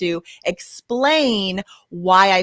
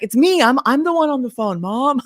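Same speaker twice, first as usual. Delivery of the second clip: mildly imitated teenage protest, pitched up; exasperated teen; 4 semitones higher than usual. Brother in the kitchen owning up to mom, mildly exasperated. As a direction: lightly mimic a son setting mom straight. Retold teenage son correcting his mother.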